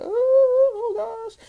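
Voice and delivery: high, slow, hammed-up squeal of nerves; fearful squeal. Told it's time, she squeals in mock fear. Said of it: "That's called fear".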